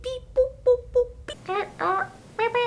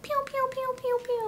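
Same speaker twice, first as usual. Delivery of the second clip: high pew pew; 13 semitones higher than usual. Shown as a demonstration. Little gun sound.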